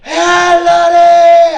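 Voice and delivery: huge hilltop shout; giant shout. Retold or imitated. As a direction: call across the whole camp from a hill. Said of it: "I stand on the hill and I shout".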